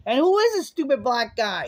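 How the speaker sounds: loud, high-pitched indignation; indignant high yell; exasperated rant. Real, not acted. Ranting on about the wrestlers.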